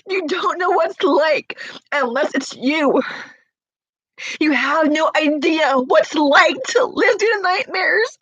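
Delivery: real tearful outburst; words breaking into crying. Real, not acted. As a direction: plead to be understood through tears. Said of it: "I'm here right now crying".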